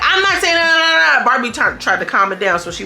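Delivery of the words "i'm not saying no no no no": high, louder imitation of protest; mock protest. Imitating Barbie refusing to go along, high mock protest. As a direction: cut in with a high-pitched, insistent refusal. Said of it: "So Barbie like".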